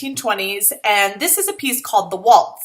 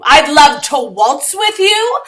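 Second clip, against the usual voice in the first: slow, louder, sarcastic echo; mock sweet repeat; 6 semitones higher, 7 dB louder, slower than usual. Girl sarcastically repeating her own forced answer, slow. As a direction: stretch it out with mock delight.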